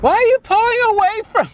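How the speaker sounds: put-on high hurt girlfriend whine; whiny girlfriend. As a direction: plead like a clingy date. Voicing girlfriend complaining.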